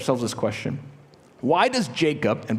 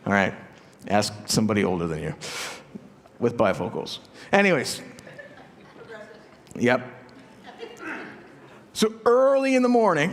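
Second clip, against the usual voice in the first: normal talking voice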